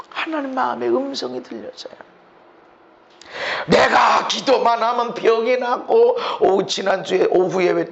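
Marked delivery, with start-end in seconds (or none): none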